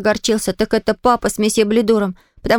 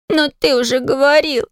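put-on crying child; 3 semitones higher than usual. A narrator's reading. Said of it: "плакал я".

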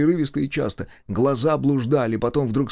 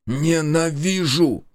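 higher, very drawn-out outburst; slow, intense loathing; seething hatred; 5 semitones higher, 3 dB louder, much slower than usual. A narrator's reading. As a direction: stretch the word with rising hatred. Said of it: "процедил сидящий напротив".

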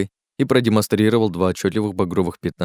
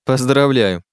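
raised, wry congratulation; sneering congratulation; 5 semitones higher, 4 dB louder, slower than usual. Acted. Jerome reacts to Dick's success, wry and scoffing. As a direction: congratulate him with a wry lift. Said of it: "хмыкнул Жером".

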